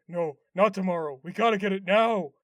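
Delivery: insistent dopey character voice; Patrick. Retold or imitated. Patrick refusing to wait for the shirt, insistent character voice. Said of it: "Patrick's like".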